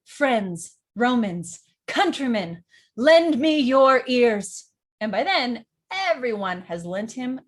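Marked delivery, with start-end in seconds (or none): 0.0-4.7 orator building to a loud, slow climax; polished build. Shown as a demonstration. Politician wins over the crowd, rising declamatory build. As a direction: declaim in separate beats, each bigger. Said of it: "this is not only a build".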